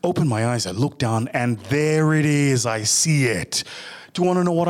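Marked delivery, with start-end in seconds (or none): none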